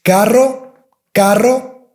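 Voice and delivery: "Carro" with a rolled rr; trilled R. Shown as a demonstration. Teacher saying car in Spanish. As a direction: roll it in Carro. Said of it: "The second one is the vehicle".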